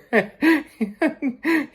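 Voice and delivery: high burst of real laughter; laughing. Real, not acted. Teller laughing at the picture of herself in the drive-thru, a high burst of laughter. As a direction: crack up at the memory.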